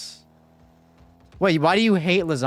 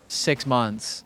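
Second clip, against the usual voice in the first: normal talking voice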